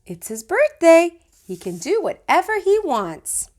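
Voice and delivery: put-on a grandmother letting the child do anything; indulgent grandma. A narrator's reading. Grandma defending Fudge at his party, lightly voiced and doting. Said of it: "But Grandma said".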